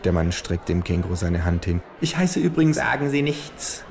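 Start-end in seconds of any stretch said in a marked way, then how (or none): 2.0-2.8 high falsetto of a small fat lobbyist. Acted. Lobbyist lecturing the kangaroo at the buffet, high falsetto. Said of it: "mit Fistelstimme". 2.8-3.8 higher, slow, dry smug kangaroo. Acted. Kangaroo fanning itself with play money, smug higher voice. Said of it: "unterbricht es ihn".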